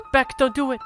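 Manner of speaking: high urgent shout; warning shout. Acted. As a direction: yell to stop her.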